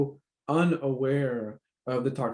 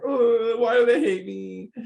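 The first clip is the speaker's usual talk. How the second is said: high, louder hurt wail; mock wounded cry over a slight; hurt whimper; 8 semitones higher, 4 dB louder than usual. To herself, hurt by someone she cares about: high mock wail. Voicing own hurt thought.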